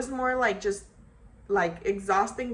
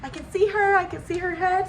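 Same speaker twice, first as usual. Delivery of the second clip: high and louder, thrilled; excited husband; 10 semitones higher, 6 dB louder than usual. Retold or imitated. Husband telling his wife he sees the baby, excited shout. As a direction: blurt it out, overjoyed.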